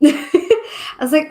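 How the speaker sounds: slow words through a laugh. Real, not acted. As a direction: laugh as you say it. Laughing while retelling reading.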